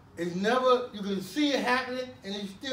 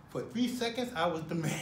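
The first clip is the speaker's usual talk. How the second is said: usual speaking voice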